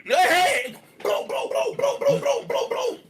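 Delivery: very high, frantic repeated bro; panicked high calling. Retold or imitated. Calling out to his friend, very high and frantic. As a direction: squeak it out in alarm.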